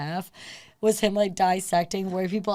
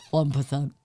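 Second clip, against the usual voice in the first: lightly voiced politician impression, lower; a hint of a senator's voice, pitched down; Bernie Sanders; 5 semitones lower than usual. Repeating it on request, light politician impression. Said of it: "That's a good Bernie Sanders".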